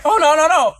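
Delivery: mock panicked woman begging; pleading quote. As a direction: plead it was an accident. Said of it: "She was like".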